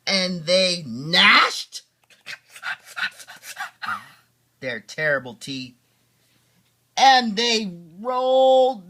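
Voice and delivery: big, slow monster-story read; dad reading the wild rumpus with gusto; dramatic storytelling. Acted. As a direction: roar through the scary bits for the kids.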